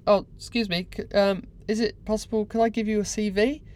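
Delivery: high, hesitant polite asking; polite request. Retold or imitated. Deckhand hopeful offers a stranger a CV, high polite request.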